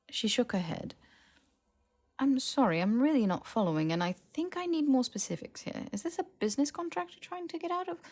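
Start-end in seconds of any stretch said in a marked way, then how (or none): 2.2-8.0 Hermione, puzzled. Acted. Hermione voiced by narrator.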